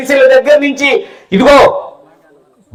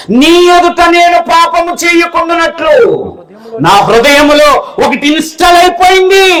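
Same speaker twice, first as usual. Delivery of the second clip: higher, shouted scripture; full-force preaching; 8 semitones higher than usual. Acted. Preacher shouting the verse to the congregation, high and forceful. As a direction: proclaim the verse to the hall.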